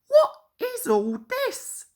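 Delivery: high, puzzled engine voice; Thomas asking. A narrator's reading. Thomas wonders about the gathering, high curious voice. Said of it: "asked Thomas".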